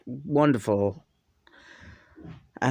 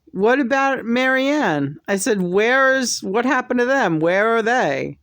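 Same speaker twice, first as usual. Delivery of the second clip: mock indignant; 8 semitones higher than usual. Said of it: "And I was like".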